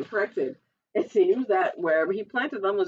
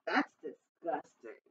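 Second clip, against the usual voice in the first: soft, slow, low disgust; 5 semitones lower, 9 dB quieter, slower than usual. Acted. Jack hearing he may be eaten, disgusted. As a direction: draw it out low and quiet.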